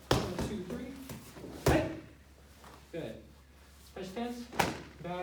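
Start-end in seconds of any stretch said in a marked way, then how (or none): 0.1-1.9 loud count ending in a kiai yell; sharp martial arts commands. Shown as a demonstration. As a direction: yell the count, explode on three.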